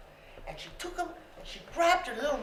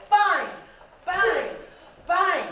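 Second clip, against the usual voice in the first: loud knocking sounds; 4 semitones higher, 7 dB louder, much slower than usual. Retold or imitated. Imitates banging the door knocker.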